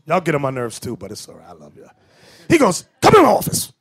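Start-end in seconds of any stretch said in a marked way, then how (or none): 3.0-3.7 high, loud summons from the boss; raised-voice order from a superior. Retold or imitated. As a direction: call him in sharply, high and loud. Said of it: "he's Jamaican".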